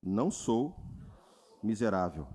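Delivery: low, calm declaration for the church to repeat; calm affirmation. Shown as a demonstration. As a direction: say it slowly and firmly. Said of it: "Diga assim". (declaração calma e firme para a igreja repetir)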